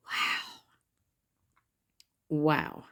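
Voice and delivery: normal talking voice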